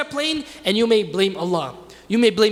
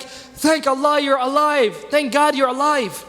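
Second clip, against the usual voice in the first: higher, slow, relieved father imitated; 5 semitones higher, slower than usual. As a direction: draw it out with a father's relief at seeing them alive. Father greets family thought dead.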